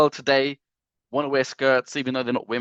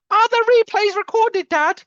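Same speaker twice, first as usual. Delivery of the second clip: put-on much higher, louder kid voice; child asking; 6 dB louder than usual. Son asking his dad about replays, high kid voice. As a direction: whine it like a little son. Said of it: "Uh, yes, son".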